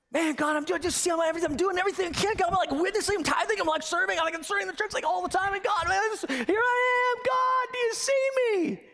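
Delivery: high, pleading, rising to a shout; a worn-out believer crying out to be seen; frantic believer begging God to notice; desperate striving believer. Acted. Voicing an exhausted striving believer.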